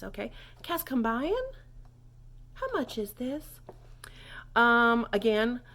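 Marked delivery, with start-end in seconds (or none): 0.6-1.5 "Kest kombuyen" higher, climbing steeply at the end; upward inflection. Shown as a demonstration. Teacher reminding learners to ask, high rising question. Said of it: "don't forget that upward inflection on your voice". 2.5-3.6 "How much is this" higher, lifting into a question; upward inflection. Shown as a demonstration. Teacher translating the question, higher rising inflection. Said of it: "don't forget that upward inflection on your voice".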